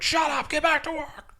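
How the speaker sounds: imitating a medicated manager; mock barking manager. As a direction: bark orders then apologize.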